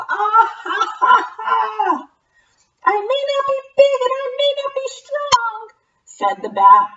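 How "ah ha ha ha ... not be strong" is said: very high, quick bat chatter; squeaky bat voice. Acted. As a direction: chirp it like a tiny bat.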